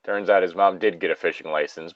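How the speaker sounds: ordinary talk, nothing marked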